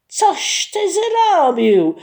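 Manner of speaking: high, loud, slow furious shout; angry shout. A narrator's reading. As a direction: yell the accusation at the brother. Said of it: "krzyknął rozzłoszczony".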